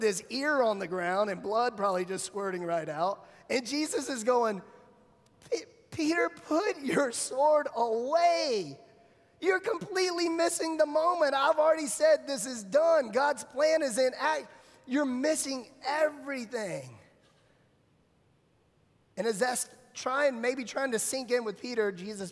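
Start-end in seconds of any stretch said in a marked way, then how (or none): 5.5-17.0 high, exasperated acted scolding; exasperated rebuke. Jesus scolding Peter for swinging his sword, exasperated rebuke.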